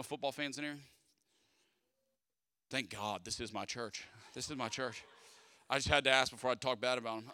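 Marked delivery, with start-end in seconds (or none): none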